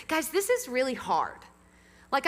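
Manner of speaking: ordinary talk, nothing marked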